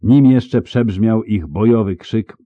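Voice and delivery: usual speaking voice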